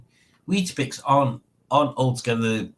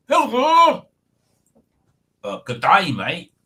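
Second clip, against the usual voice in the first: a lightly voiced cheery Dave; mock Aussie hello, high on the hello; imitating Dave's greeting; 7 semitones higher, 5 dB louder, slower than usual. Imitating absent Dave's usual greeting, cheery hello. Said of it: "That's how he normally does it".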